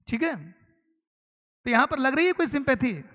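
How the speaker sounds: normal talking voice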